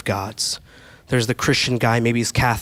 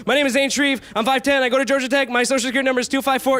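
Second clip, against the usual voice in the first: much higher, nervous babble of everything; panicked high rush, faster at the end; backing off; 11 semitones higher, faster than usual. Retold or imitated. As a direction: spill it all out to an angry mom. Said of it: "But I, I backed off".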